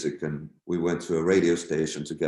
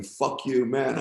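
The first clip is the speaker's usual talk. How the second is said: mild mock yell, raised in pitch; toned-down retold outburst; 5 semitones higher, slower than usual. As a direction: imitate the yelling without full volume. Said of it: "with his fingers up and yelling".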